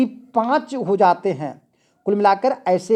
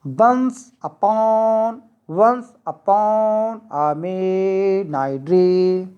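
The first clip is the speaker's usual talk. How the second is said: "once upon a midnight dream" very slowly, beat by beat; trochaic scansion; much slower than usual. Shown as a demonstration. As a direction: chant out the beats, stretching "upon" and "dream". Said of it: "पहला stress, दूसरा unstress". ("once upon a midnight dream" लय में, बहुत खींचकर)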